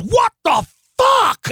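a furious, loud yell from a big man who just got punched; biker's shout. Retold or imitated. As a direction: bellow like a hit biker. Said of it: "he goes".

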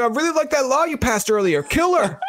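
high cheeky greeting to the prime minister; host teasing Trudeau in a bratty voice; cheeky mock voice. Host's cheeky self.

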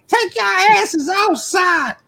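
high, slow mock shout; shrieked imitation of grandma's scolding; cussing grandmother. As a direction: holler it like a furious grandma, hammed up. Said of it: "our grandmother cussing us out".

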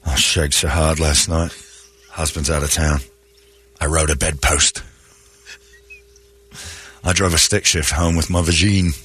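low, sleepy put-on voice; low mock boast; bragging female coworker. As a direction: drop low and toss it off between pauses. Acting woman bragging at work.

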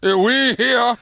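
high, loud, slow pompous tycoon; Bob Kraft snooty rich guy voice. Acted. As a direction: puff up like a snooty rich owner. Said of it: "that's my snooty rich guy voice".

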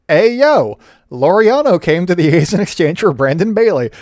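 half-hearted wrestler voice on the intro; bad Razor Ramon impression. Podcaster sneaks in a bad wrestler impression, hammed-up. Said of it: "such a bad Razor Ramon impression".

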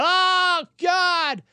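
high, hammed-up groan of pain; exaggerated sick-man wail to God; mock pained moan. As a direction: moan it like a whiny patient.

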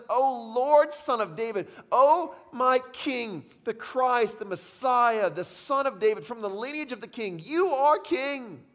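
high, loud, slow exalted praise; woman proclaiming Jesus as King, rising to a loud peak; adoring woman. Retold or imitated. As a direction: cry out his titles in worship. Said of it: "and she says".

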